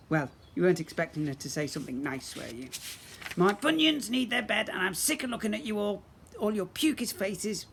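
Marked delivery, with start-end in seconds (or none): 3.4-7.7 grumpy granny voice. Acted.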